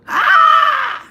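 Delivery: sudden very high, very loud release cry; primal shout. Real, not acted. Spiritual leader leading the group, shouting it out: huge yell. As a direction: let out one huge raw yell. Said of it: "we're gonna shout it out".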